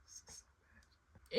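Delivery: crying whisper; whispered through tears. Real, not acted. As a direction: breathe it out in tears. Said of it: "blurry from the tears".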